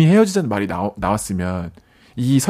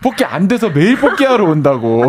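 talking through laughter; laughing; 4 semitones higher, 3 dB louder than usual. Real, not acted. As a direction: laugh out the words. Laughing at funny story.

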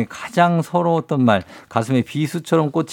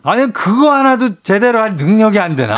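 contemptuous boss; 6 semitones higher than usual. Shown as a demonstration. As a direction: belittle the employee with a laugh. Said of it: "한번 해주세요".

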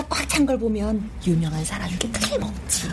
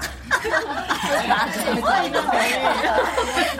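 breathy laughter; 4 semitones higher than usual. Acted. Class laughs at lecturer's joke.

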